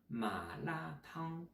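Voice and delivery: ma la tang said slowly with Chinese tones. Shown as a demonstration. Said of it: "la soupe pimentée et ma fourmillante". (ma la tang prononcé lentement en chinois)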